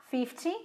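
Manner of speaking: "fifty" with the weight on "fif"; "fifty", front-stressed with a light ending; stress on the first syllable. Shown as a demonstration. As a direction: hit the start of "fifty", let "ty" drop. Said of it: "a sílaba tônica tá no começo".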